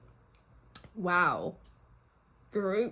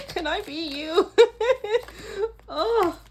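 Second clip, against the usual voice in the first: high, teary laugh-cry; laughing through real tears, voice pitched high; overcome, half laughing and half crying; tearful; 11 semitones higher, 3 dB louder than usual. Real, not acted. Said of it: "I've got tears in my eyes".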